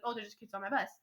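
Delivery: usual speaking voice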